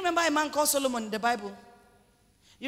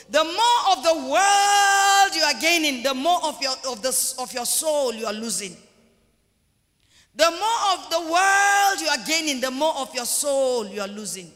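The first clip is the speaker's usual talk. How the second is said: impassioned preaching; 6 dB louder than usual. Real, not acted. Preacher warns church about losing the soul, impassioned. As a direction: cry out the warning, then let it fall. Preacher drives warning home.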